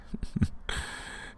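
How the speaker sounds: low breathy villain chuckle; soft evil chuckle. Acted. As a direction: chuckle under your breath with contempt. Vampire mocking her prisoner.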